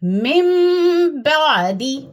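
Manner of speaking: मिन said as मिम, nasal hum held long before बादी; iqlab with ghunna. Shown as a demonstration. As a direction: stretch मिन into a humming मिम for two seconds. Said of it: "पढ़िए". (मिन को मिम बनाकर गुन्ना से खींचना)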